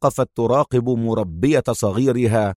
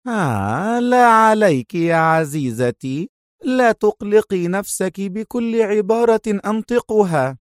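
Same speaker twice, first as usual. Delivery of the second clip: sinister smiling sweetness; 8 semitones higher than usual. Acted. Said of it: "ابتسامة مخيفة".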